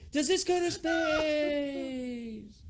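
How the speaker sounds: very high, drawn-out slow shout; screaming child. Retold or imitated. Son shouting in panic as the coaster takes off, very high and slow. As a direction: wail the question as the ride launches. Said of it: "I can remember".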